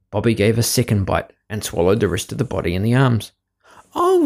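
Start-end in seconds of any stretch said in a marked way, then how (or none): 3.9-4.3 high little dismayed cry; a tiny high wail of dismay; gingerbread man voice. A narrator's reading. Gingerbread man being eaten.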